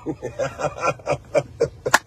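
low, quiet run of chuckles; drawn-out soft laugh; chuckling. Real, not acted. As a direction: chuckle softly and steadily, low in pitch. Amused during own live video.

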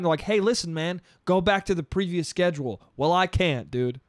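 normal talking voice

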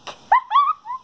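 shrieking grossed-out giggle; high squeal laugh. Real, not acted. Grossed out by sticky candy.

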